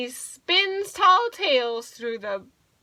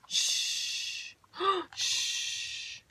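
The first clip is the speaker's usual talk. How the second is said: soft breathy "shh" snore; sleeping bear's whistleless snore; snoring sound; 6 semitones higher, 7 dB quieter than usual. Pretend. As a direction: snore out long, hushed exhales. Bear snoring in the story.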